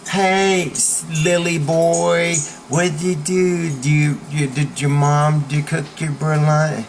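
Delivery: put-on bully voice, slow drawn-out jeering; bully needling his target; taunting bully. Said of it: "The bully goes".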